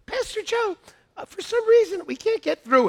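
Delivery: high-pitched, hesitant imitation; timid staff member. Staff member reporting failure to the pastor, timidly high-pitched. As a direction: mimic a wary employee in a high voice. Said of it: "and then they back away".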